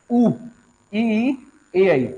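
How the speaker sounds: ऊ, ई, ए, आई sounded out one by one; vowel sounds demo. Shown as a demonstration. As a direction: run through the vowel sounds slowly for students. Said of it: "ऊ का उच्चारण होता है". (स्वरों को एक-एक करके बोलकर दिखाना)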